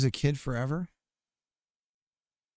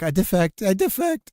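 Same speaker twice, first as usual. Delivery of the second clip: mock crying; 6 semitones higher, 5 dB louder than usual. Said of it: "he starts crying".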